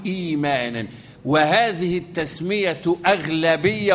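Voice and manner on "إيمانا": إيمانا with long opening ee, no inner stop; smooth long ee at the start of إيمانا; substituted long vowel. Shown as a demonstration. Teacher concludes the substitution, إيمانا with a long ee. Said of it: "أبدلها إيه؟ يا، أقول".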